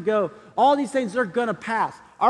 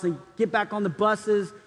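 usual speaking voice

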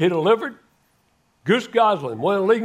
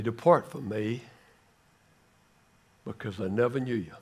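saddest words; 7 semitones lower, 7 dB quieter than usual. Retold or imitated. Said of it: "reply with the saddest words in the Bible".

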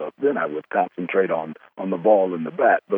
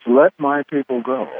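voice of God line; 5 semitones higher than usual. Shown as a demonstration. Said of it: "I would love to have you use the line".

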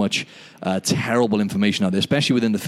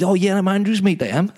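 high, sing-song Welsh accent impression; [name]'s Welsh accent; 10 semitones higher than usual. Welsh stranger introducing himself as a friend's mate, high imitated Welsh accent. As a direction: imitate a Welshman, pitched well up. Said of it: "Welsh accent".

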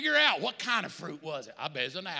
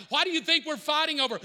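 ordinary talk

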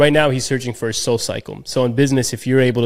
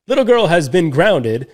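newscaster voice; 6 semitones higher, 4 dB louder than usual. Retold or imitated. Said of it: "she's doing a newscast".

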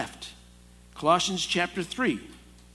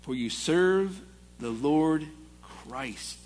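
scripture reading; 3 semitones higher, 3 dB louder than usual. Acted. Preacher reads Colossians aloud.

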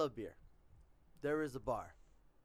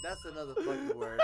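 laughing; 7 semitones higher than usual. Real, not acted. Laughing at her own slip.

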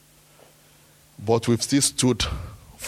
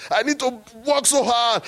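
higher again, "work" leaned on; repeating the plea more insistently; mock protest, second take; 10 semitones higher than usual. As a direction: push the plea up further. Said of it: "I said".

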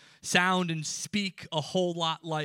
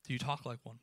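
softer, lower repeat of do you talk like one; quiet repeat; 3 semitones lower, 8 dB quieter, faster than usual. Acted. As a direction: let it sink in softly. Repeated challenge question.

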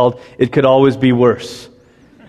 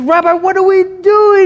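hammed-up frantic plea; desperate plea. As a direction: beg the rabbi in comic panic. Pleading again, more desperate.

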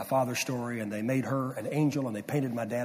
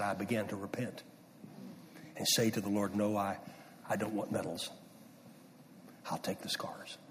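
quiet, tearful; 4 semitones lower, 9 dB quieter than usual. Retold or imitated. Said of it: "my dad was weeping".

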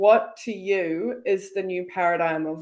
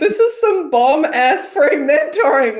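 loud excited praise; 6 semitones higher, 10 dB louder than usual. Real, not acted. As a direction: hype up the mentoring. Enthusing over the advice.

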